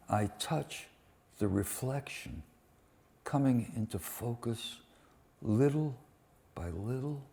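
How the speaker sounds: quiet, measured reading of a poem. Acted. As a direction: read each line softly with pauses.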